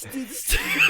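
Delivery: loud announcing. Acted. Announcing witness in courtroom game.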